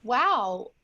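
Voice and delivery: normal talking voice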